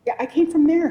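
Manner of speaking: put-on high, loud little boy insisting; young son's voice. Small son insisting to his mom about the stars, high and loud. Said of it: "he said".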